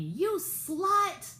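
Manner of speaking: mock screamed insult. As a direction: hurl the insult across the lobby, imitating. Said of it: "screamed out at me".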